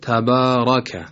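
slow تبارک with a thick, heavy ra. Shown as a demonstration. Tajweed teacher showing how it should sound, heavy ra in تبارک. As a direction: draw out تبارک, r and following vowel full and dark. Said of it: "یعنی درشت تلفظ بشه، اینطور".

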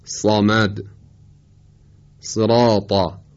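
heavy Quranic recitation of صراطا; with isti'la. Shown as a demonstration. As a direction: say صراطا slowly, the vowels dark and full.

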